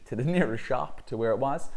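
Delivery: usual speaking voice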